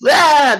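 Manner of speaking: put-on short high loud squeal like a toddler; baby's shriek. Streamer imitates a messy baby on a high chair, sharp high squeal. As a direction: shriek like a baby flinging cereal. Baby throwing food around.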